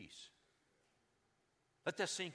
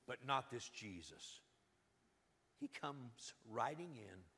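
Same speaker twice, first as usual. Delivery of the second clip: ordinary delivery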